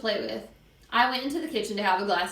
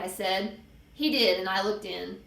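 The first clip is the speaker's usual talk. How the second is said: usual speaking voice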